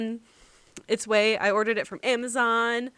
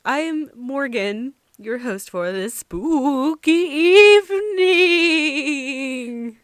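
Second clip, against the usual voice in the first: spooky voice; 3 semitones higher, 7 dB louder, slower than usual. Pretend. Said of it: "try to do a spooky voice".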